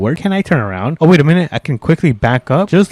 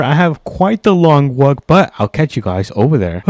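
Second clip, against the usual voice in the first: ordinary talk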